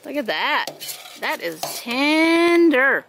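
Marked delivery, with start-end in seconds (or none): 1.2-3.0 savoring. Real, not acted. Admiring cooked meat.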